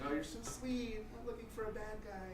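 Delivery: high, soft, sugary letdown; women turning him down. Retold or imitated. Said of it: "they're like".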